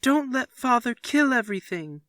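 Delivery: put-on high, loud child's wail; boy wailing to the house. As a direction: wail it up at the ceiling. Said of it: "wailed Peter at the ceiling".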